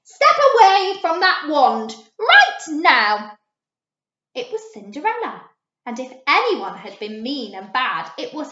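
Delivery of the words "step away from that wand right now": loud, slow, angry order. A narrator's reading. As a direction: command it furiously. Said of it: "an angry voice".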